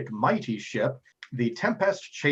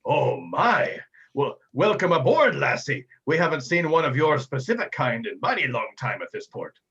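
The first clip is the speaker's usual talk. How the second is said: brash captain voice, admiring; Captain Tumult Viral; 3 semitones higher, 4 dB louder than usual. Acted. Captain welcomes the elf maiden aboard, hearty admiring voice. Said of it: "Captain Tumult, uh, Viral sees".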